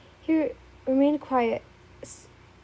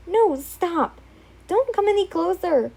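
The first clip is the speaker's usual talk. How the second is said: higher, louder scared yell; frightened shout; 7 semitones higher, 5 dB louder than usual. Acted. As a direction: back away shouting. Frightened refusal to intruder.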